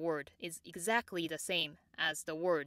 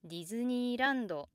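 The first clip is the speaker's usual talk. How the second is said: Japanese Disneyland with la lifted above the high plateau; slow Disneyland, peaking on la, then down; extra high LA; 5 semitones higher, slower than usual. Shown as a demonstration. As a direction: push la higher in Disneyland before the downstep. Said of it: "la might be pronounced extra high".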